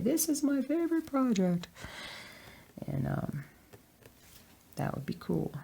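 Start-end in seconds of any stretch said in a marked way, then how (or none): 0.0-1.7 high voice of an excited fan; imitating a fan. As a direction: play an eager viewer. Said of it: "people are gonna start to say".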